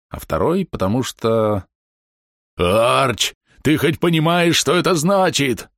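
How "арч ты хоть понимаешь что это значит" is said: excited shout. Retold or imitated. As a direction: demand it while shaking a friend. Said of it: "тряс он меня за плечи".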